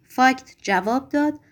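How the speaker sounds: ordinary delivery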